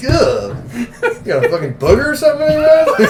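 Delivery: loud, high sneering Frenchman; mock rude Frenchman. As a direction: whine it like a snob. Mocking a French speaker.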